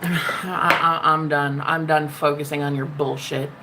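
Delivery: low, weary dismissal after a sigh; sigh, then drop low and dismiss them; fed up. Real, not acted. Host done with a troll, fed-up low voice.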